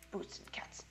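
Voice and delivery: whispering the meme line; whispered beatbox line from an ad; whispered quote. Retold or imitated. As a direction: breathe it out without voice. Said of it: "like, saying".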